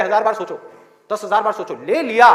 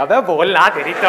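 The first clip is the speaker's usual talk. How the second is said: riled-up audience; 4 semitones lower, 5 dB louder than usual. Acted. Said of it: "लोग बौखला जाएंगे".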